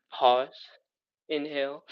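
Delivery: ordinary delivery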